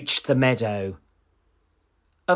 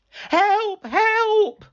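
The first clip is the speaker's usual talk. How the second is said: high, loud yell for help; high, loud fake-alarm yell; shouting for help; 4 dB louder than usual. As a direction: call out in alarm to the village. Boy shouting wolf.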